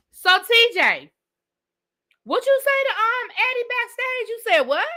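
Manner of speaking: high, mocking questions to TJ; sassy interrogating voice. Host grilling TJ on speakerphone, high and mocking. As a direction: needle TJ in a high, sly voice. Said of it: "I'd have been like".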